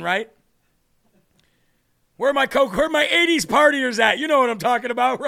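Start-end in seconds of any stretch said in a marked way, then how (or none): 2.2-5.2 louder, higher rally call to the crowd. Acted. Host calls out to eighties partiers, loud and high. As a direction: shout it out to the old party crowd.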